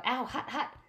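high yelps of pain, easing off. Acted. Grover holding the burning whip, high pained yelps. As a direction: yelp from a burned hand. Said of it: "he yelled".

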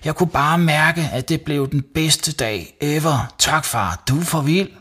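put-on high, excited boy's voice; thrilled. Savi thanking dad for the best day, thrilled. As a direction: gush with delight. Boy thrilled at dad's promise.

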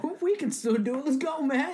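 high-pitched eager agreement; hyped up. Pretend. Friend joins the plan to go back, hyped up. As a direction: jump in high and keen to go.

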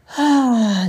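loud, high falling sigh; exasperated sigh. Real, not acted. Speaker after her failed accent attempt, exasperated sigh. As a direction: let out a big, loud sigh.

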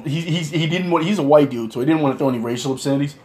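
ordinary delivery

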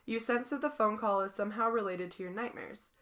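usual speaking voice

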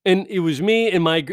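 ordinary talk, nothing marked

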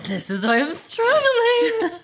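high, slow, half laughing half crying; laughing wail. Real, not acted. Co-host wailing about her own struggle, laughing and high.

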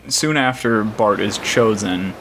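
ordinary talk

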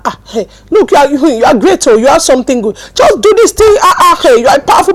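loud, punchy hype, rising in pitch; rousing, loud pep talk; forceful African encouragement. Acted. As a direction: cheer them on hard, African style. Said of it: "there's a way we used to encourage in Africa".

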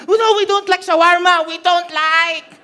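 loud, high, hammed-up refusal; mock shouting. Neighbors refusing shawarma in a hammed-up loud quarrel. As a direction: belt it out like a stubborn neighbor.

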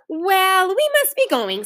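high-pitched neighbourly sign-off; polite leave-taking. A narrator's reading. Neighbour takes leave of the girls, politely.

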